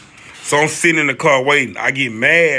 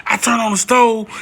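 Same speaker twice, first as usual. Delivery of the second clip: ordinary delivery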